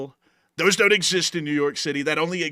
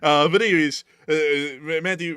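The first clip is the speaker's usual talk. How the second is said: normal talking voice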